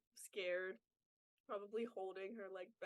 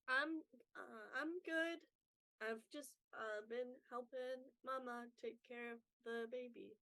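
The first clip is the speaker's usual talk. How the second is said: put-on shy little girl; 3 semitones higher than usual. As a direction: answer timidly, eyes on the ground. Shy girl answers the old lady.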